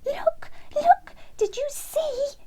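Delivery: very high, loud excited bird voice; a little wren bursting with pride; high excited squeaky wren; joyful and excited. A narrator's reading. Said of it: "joyful and victorious and so excited".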